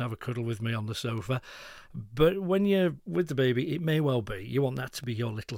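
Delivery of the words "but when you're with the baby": ordinary talk, nothing marked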